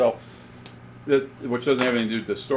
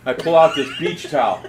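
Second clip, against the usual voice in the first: ordinary delivery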